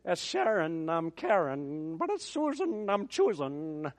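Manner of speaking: a hint of a slurred drunk Irishman, slow. Preacher recalling a drunk character from Finian's Rainbow, lightly slurred. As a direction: drag the words like a tipsy singer. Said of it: "it kinda had a slurred".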